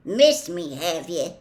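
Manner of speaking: gruff, scornful retort; rough, derisive comeback; snorted. A narrator's reading. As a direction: snort it in disbelief. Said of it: "snorted Hagrid".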